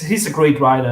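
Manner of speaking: normal talking voice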